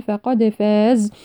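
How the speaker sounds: slow chant, releasing the d of فقد with a bounce; echoing dal. Shown as a demonstration. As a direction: give فقد an echo on the dal, then hold فاز. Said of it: "نقلقل الدال". (قلقلة واضحة في دال فقد)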